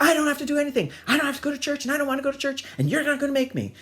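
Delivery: high, loud bratty protest; mock child tantrum. Child defying mother from bathroom, mock tantrum. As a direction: play a kid shouting his refusal.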